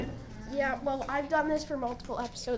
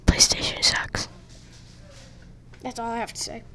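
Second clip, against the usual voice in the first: whisper. Pretend. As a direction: lean in and whisper the secret. Mock secret aside.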